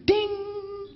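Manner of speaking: high ringing ding, drawn out; ding of a bell. Pretend. Comedian tallying points for acts of kindness, ringing ding. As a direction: imitate a scoreboard bell, sustained.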